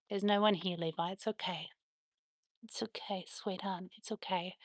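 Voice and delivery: very soft, tender murmur; calm a worried pet under your voice; soothing reassurance. Real, not acted. Owner reassures dog nobody is here, soft and soothing.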